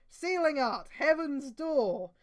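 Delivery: high, loud, slow incantation; anime spell call. Acted. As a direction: call out the technique name. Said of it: "decides to seal Ripper Zell".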